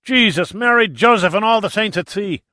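high, loud, furious exclamation; erupting in a shout. Acted. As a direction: blow up like a volcano. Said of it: "McGinty erupted like a volcano".

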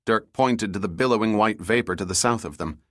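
plain reading voice

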